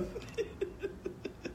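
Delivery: high, voiceless wheezing laughter; soft, squeaky laughing fit; helpless laugh. Real, not acted. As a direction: laugh you can't hold back. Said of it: "I'm not trying to laugh".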